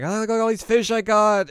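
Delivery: put-on high, loud childish glee; little girl voice. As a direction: show off your fish like a proud little kid. Voicing little girl Ren.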